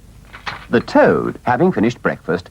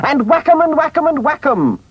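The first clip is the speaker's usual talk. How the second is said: high, bouncing gleeful cry. A narrator's reading. Toad leaping in the air before battle, gleeful high cry. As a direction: Toad whoops as he leaps. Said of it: "cried the Toad, leaping into the air".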